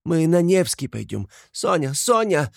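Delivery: high, agitated calling, rising to alarm; frightened cries. A narrator's reading. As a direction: call after the children in sudden panic. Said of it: "вскрикнула она вдруг в испуге". (испуганно окликает детей высоким голосом)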